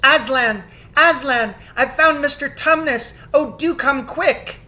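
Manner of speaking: high, loud eager shouting; excited shout. A narrator's reading. Lucy rushing upstairs calling Aslan, high and excited. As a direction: call Aslan in delight. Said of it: "shouting out".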